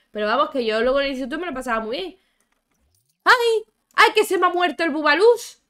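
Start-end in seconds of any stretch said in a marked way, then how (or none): 3.2-5.6 high, loud shriek of dismay; shocked cry. Real, not acted. Gamer shrieks as her pet dies, alarmed and loud. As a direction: yelp in alarm at the dead pet.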